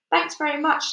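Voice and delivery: curt sarcasm; snapped. A narrator's reading. Fire chief snaps back at the police chief, sarcastic.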